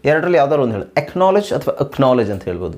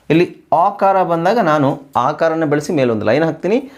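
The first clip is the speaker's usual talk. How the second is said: ordinary talk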